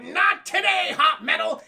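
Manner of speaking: high, loud heroic cry; defiant mock shout. Alice shielding Angie, hammed-up defiant shout.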